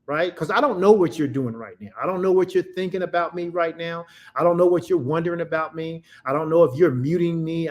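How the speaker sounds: normal talking voice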